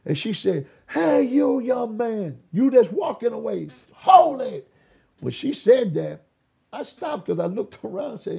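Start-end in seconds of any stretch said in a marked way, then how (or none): 0.9-4.7 drawn-out raised call after someone leaving; high, loud preacher's summons. Retold or imitated. Preacher hailing a young man walking away, calling out.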